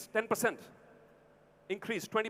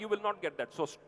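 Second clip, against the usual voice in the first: usual speaking voice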